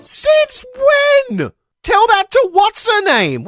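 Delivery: high, loud incredulous yell; comic indignant shouting; outraged retort. Acted. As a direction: yell back at the screen. Outraged retort to the film's line.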